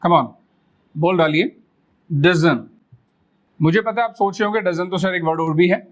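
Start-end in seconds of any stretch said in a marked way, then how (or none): 2.1-2.7 डजन with the t dropped; doesn't as dozen. Shown as a demonstration. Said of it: "बोलना है डजन".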